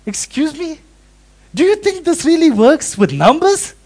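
high, loud, indignant huffing; indignant passenger. Retold or imitated. Preacher mimics a rude plane passenger, high and indignant. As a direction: play the offended man in the wrong seat. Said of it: "He was like".

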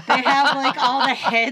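can barely get it out for laughing. Real, not acted. Laughing while describing the scene.

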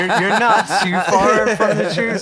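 real laughter at a joke that landed; laughing. Real, not acted. Guest laughing as the host guesses the video.

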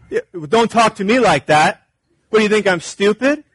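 hammed-up touchy comeback; mock indignation. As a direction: act out wounded pride, pushing back hard. Acted offended parachute jumper snaps back.

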